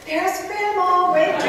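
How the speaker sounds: put-on high, loud, drawn-out call to the kids; hammed-up delight, pointing out Grandma; excited calling out. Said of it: "I go".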